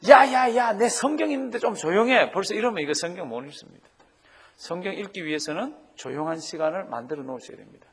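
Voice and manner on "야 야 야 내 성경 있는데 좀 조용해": loud, higher snap for silence. Retold or imitated. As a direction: yell at the family to pipe down. Family noise while reading Bible.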